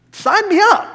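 high, loud burst of enthusiasm; thrilled exclamation; eager volunteering. Acted. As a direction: jump at the offer. Eager response to promised blessing.

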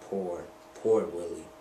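slow, sorrowful pity; almost crying over a friend; near tears. A narrator's reading. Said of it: "Alexander was almost in tears".

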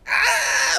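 high piercing mock scream; imitating a terrified yell; retold scream. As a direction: shriek like you're being pecked. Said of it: "his yell got louder".

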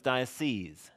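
diocese ending in a long "seez"; plural pronunciation. Shown as a demonstration. Teacher showing what an added E does, plural pronunciation. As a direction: say diocese slowly as DIE-uh-seez. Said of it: "Throw an E on the end".

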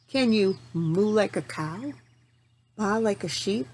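ordinary delivery

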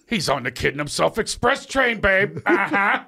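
mocking teacher's snide aside, high and loud; imitating acting teacher. Teacher mocking Mickey Rourke types in class, high catty sneer. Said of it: "he would sit there and go".

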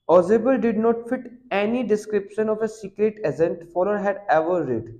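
reading the English passage slowly and deliberately; slow text reading. Acted. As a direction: read each word out slowly for the class. Reads the English text aloud. (धीमी गति से पाठ पढ़ना)

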